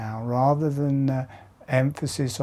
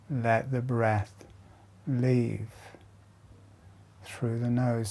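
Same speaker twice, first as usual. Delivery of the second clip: guided meditation. Acted. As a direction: lead them gently through each breath. Guides slow jaw breathing exercise.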